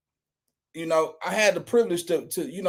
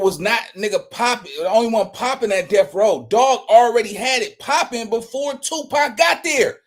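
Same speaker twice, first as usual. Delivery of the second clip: ordinary talk, nothing marked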